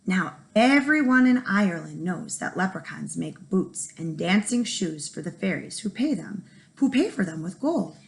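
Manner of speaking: ordinary delivery, nothing marked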